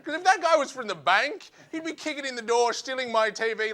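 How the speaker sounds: normal talking voice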